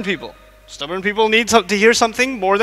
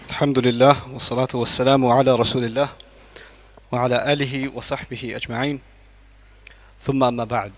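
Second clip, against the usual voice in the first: formal opening; 10 semitones lower, 5 dB quieter, faster than usual. Acted. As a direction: open the session solemnly. Formal Arabic opening after adhan.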